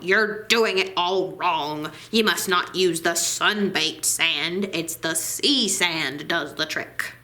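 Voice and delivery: grumbling sea captain laying down the rule; gruff old sailor. Acted. Swashby correcting the girl.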